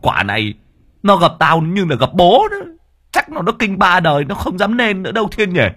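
put-on high, gloating old man's laughter through the words; old man brags to his nephew, laughing; cackling with glee. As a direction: crow over the fleeing ghost. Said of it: "cười khành khạch".

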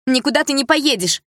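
high loud forbidding shout; mother yelling. A narrator's reading. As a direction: shout the order. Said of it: "выкрикнула мама". (мать кричит на дочь, запрещая)

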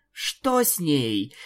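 plain reading voice

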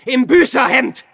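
triumphant shout. Acted. Hermit shouting proudly about monks in hair shirts. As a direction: fling it out in fierce pride. Hermit boasting of his penance.

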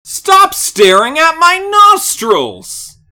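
high, slow indignant shout; hammy character yell. Acted. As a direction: yell it like an offended cartoon. Quoting a character, yelled.

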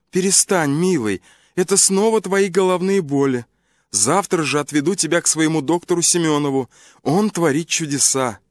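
a caring wife brushing off his worries; wife's voice. Acted.

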